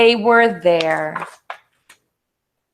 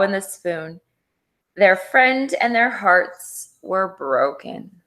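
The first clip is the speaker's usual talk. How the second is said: ordinary delivery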